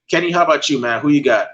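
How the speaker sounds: ordinary talk, nothing marked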